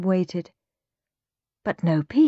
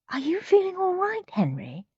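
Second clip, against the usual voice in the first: higher, concerned mother's voice; worried mum; 12 semitones higher than usual. A narrator's reading. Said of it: "mother worries about Henry".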